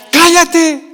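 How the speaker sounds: loud, high acted shout; imitated sharp order to be silent; crowd's rebuke. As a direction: shout someone down as the crowd would. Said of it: "la gente decía que".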